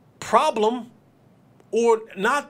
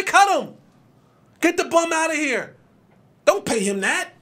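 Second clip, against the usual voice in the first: mock fan yell; 6 semitones higher than usual. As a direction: jeer at the washed-up player. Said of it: "you say".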